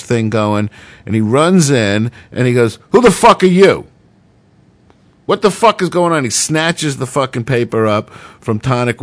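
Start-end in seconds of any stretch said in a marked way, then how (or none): 2.9-6.2 loud, higher furious yell; the intruder bursts in yelling at a stranger; angry shout. Retold or imitated. Director bursts into interview, shouting angrily.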